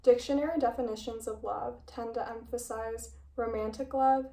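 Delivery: usual speaking voice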